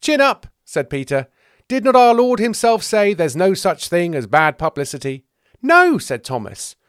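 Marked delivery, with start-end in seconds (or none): 0.0-0.5 loud, high encouragement; punchy pep talk; hearty rallying. Acted. Peter rallies weeping Thomas. 1.7-5.2 loud, high, emphatic rhetorical question; raised, hearty pep talk; rallying oratory. Acted. Peter rallies weeping Thomas. 5.6-6.0 very loud, high "No"; shouted contradiction; exasperated denial. Acted. Thomas flatly contradicts Peter.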